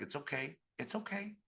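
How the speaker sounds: put-on soft, rising coo to a hurt baby; soothing mama. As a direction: imitate a mother gently comforting her little one.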